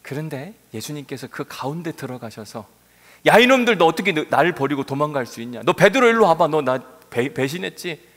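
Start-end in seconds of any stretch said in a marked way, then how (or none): 3.2-8.1 angry rebuke. Pretend. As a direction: yell at the deserters. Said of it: "라고 말하지 않고". (배신한 제자들에게 크게 호통치는 말투)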